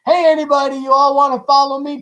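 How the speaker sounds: high, loud call to a crowd; recruiter calling out. Retold or imitated. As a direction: call out like a recruiter rallying people. Jesus voiced as recruiter calling.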